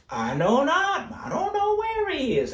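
high, dismissive denial; Cain lying. Acted. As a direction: brush God off with a lie. Preacher voicing Cain's lie.

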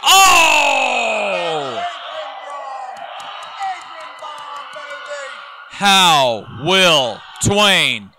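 excited ringside play-by-play; wrestling commentator. Acted. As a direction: call the near fall live. Live play-by-play of the match.

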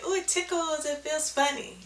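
mock kid voice, amused; imitating a kid. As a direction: play a giggly surprised child. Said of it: "the kids may say".

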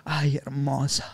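slow breathy whisper; flirty whisper. Retold or imitated. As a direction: whisper it slowly and close. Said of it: "tú con el audio así, como estás escuchando".

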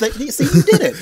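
laughing through the words; high, loud delighted laughing. Real, not acted. Cohost cracks up at the accent.

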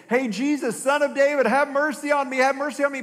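high, loud, insistent begging; calling out. Acted. Blind beggar calling to Jesus, urgent calling out. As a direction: plead loudly to be noticed.

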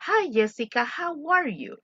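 "how are you?" with a clear rising lilt on "are"; intonation shown. Shown as a demonstration. Teacher to class, showing question intonation. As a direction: sing the greeting with marked intonation. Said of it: "le doy la entonación".

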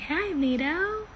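high, soft hello; sweet, drawn-out, quiet high greeting; cutesy greeting. Real, not acted. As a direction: greet a friend in a small high voice. Greets a friend joining.

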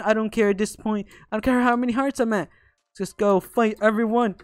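ordinary delivery

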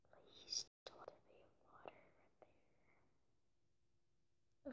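hushed, breathy prayer under the breath; whispered plea. Real, not acted. Gamer pleads to himself while digging, whispering. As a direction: whisper it like begging your luck to hold. Hoping aloud there's no water.

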